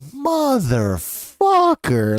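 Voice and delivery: put-on slow, drawn-out curse like a grumpy old man.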